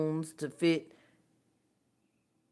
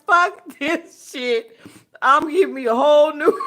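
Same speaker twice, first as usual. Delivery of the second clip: mock rich person fed up; 7 semitones higher, 8 dB louder than usual. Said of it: "they was like".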